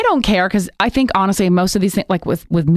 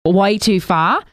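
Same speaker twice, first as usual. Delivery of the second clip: opinionated Aussie, put-on accent; conversational imitation of an Australian speaker. Shown as a demonstration. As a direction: give your verdict in an Australian accent. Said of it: "let's go Australian".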